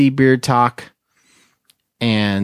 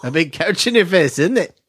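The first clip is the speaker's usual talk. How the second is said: playful put-on Australian accent, higher pitch; 4 semitones higher than usual. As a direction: ham up a goofy Aussie voice, pitched up. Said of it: "always doing this Australian accent".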